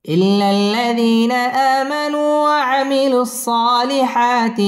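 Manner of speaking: slow, drawn-out melodic recitation; Quran recitation. Shown as a demonstration. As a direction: chant it slowly, tajweed style. (ধীর সুরেলা কুরআন তিলাওয়াত)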